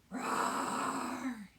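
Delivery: loud mock bear roar; playful roar. Shown as a demonstration. Yoga teacher roaring out the breath with the kids, playful roar. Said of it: "Roar it out".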